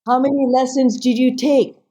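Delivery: high-pitched probing question; incredulous daughter. Retold or imitated. Daughter interrogates her mother.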